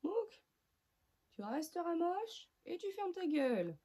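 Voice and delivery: very high, mock-rude little voice; talking cream product. Squirting product tells its user off, high mocking voice. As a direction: voice the product insulting you in a high squeak. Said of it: "Il m'a giclé dessus en mode".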